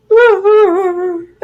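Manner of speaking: high imitated boo-hoo; hammed-up crying noise; mock burst of sobbing. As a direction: act out bursting into tears. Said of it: "And I, like, burst out".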